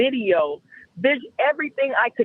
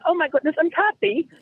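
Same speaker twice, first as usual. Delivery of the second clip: higher, lightly voiced alter ego; put-on other voice; 5 semitones higher than usual. Woman introduces her other self to partner, higher put-on voice. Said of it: "talking in my other voice".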